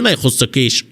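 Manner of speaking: ordinary delivery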